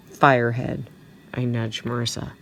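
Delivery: plain reading voice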